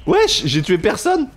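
high, slow stunned disbelief; can't believe it, drawn out; disbelieving. Real, not acted. No kills, disbelief.

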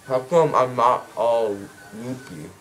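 ordinary delivery

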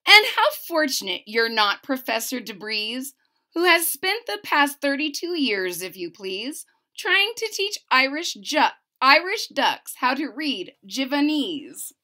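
lively, bouncy read-aloud of rhymes; storybook narration. A narrator's reading. Reading a rhyming book to a child, lively narration.

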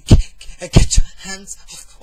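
loud, broken, stuttered repetition; terrified stammer; stammering in fear. A narrator's reading. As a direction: force the words out in panic. Beaten man stammers the phrase.

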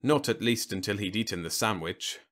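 usual speaking voice

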